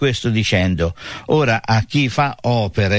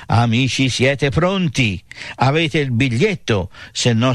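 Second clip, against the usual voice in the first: normal talking voice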